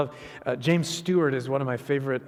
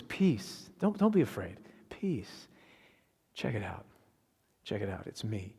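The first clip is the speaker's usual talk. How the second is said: hushed, soothing, with pauses; calm them softly, sinking low by the end; gentle reassurance; 4 semitones lower, 5 dB quieter than usual. Acted. Preacher as Jesus calming frightened disciples, gently reassuring.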